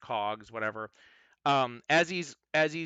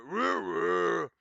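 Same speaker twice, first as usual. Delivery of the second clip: golem grunt; 5 semitones higher than usual. Acted. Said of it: "he nods at her".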